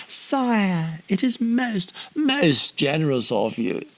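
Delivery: high oily courtier; slimy tone. A narrator's reading. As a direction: ooze flattery like a groveller. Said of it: "they spoke in tones of great sliminess".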